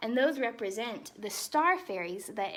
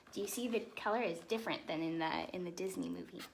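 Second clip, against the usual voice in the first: ordinary delivery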